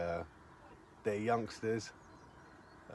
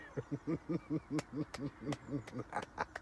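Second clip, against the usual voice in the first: long, quieter chuckling laugh; real laughter; 3 semitones higher, 6 dB quieter than usual. Real, not acted. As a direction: tail off into helpless laughing. Amused at mate's nicked fish.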